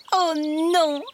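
high, emphatic denial; children answering together. Acted. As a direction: answer Mum brightly like two kids. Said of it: "répondent-ils en chœur".

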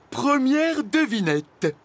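high, loud, drawn-out announcement; announcing. A narrator's reading. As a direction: announce it like a game show. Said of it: "annonça Flamèche". (annonce solennelle, haute et étirée)

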